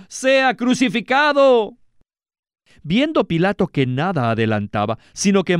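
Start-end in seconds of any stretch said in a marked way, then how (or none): 0.1-1.8 high, slow crowd shout, pushed harder; the mob yells it again with more force; shouting even more. A narrator's reading. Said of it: "gritaban aún más".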